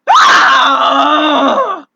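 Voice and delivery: sudden loud high shriek; startled scream. Acted. Something startles the clerk, a loud high scream. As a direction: scream out in shock.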